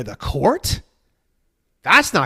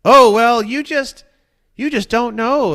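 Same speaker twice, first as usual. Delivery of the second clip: higher and louder, dismissive sing-song; smug evasive purist; 7 semitones higher, 10 dB louder than usual. Retold or imitated. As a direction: brush off the question condescendingly.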